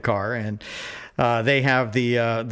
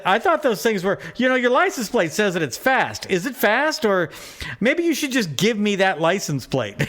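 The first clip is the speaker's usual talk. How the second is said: taunting; 8 semitones higher than usual. Retold or imitated. Retold own taunting of driver.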